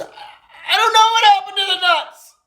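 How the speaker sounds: very high, stammering wail; hammed-up fluster. Johnny answering his mom about the nuts, hammed-up panic. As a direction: squeal it in mock distress.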